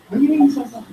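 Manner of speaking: mock challenge, voice lifting; confrontational retort. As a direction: act out someone picking a fight. Acted provoker rejects an apology.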